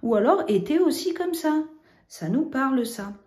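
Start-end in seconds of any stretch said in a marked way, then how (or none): 0.0-1.6 été said much higher and louder; second way, higher. Shown as a demonstration. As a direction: pitch it up to show the other way. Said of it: "on peut utiliser été comme ça". (plus aigu et plus fort)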